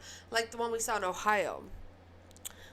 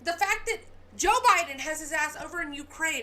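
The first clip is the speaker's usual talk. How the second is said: getting mad; 3 semitones higher, 6 dB louder than usual. Real, not acted. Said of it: "and I'm getting mad".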